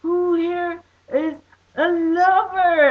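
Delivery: high, loud, slow joyful cry; answering the preacher, high and ecstatic. Retold or imitated. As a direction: sing it out with full heart. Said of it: "And I totally meant it".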